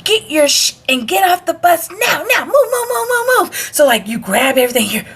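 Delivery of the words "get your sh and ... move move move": very high mock drill commands, rapid repeats; imitated yelling. Instructors herd recruits off the bus, imitated yelling. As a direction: imitate an instructor hurrying everyone along. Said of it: "when they're yelling at you".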